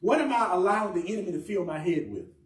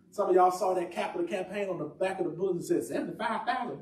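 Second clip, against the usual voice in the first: ordinary talk, nothing marked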